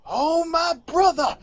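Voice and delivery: high, slow, stretched-out preacher cry; mock preacher wail. Cohost copies the testify bit as a hammed-up preacher wail. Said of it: "I gotta do that a- as well".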